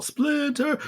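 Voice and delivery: a high mock cry for the master; calling out. Turtle climbing, calling for Splinter, high mock cry.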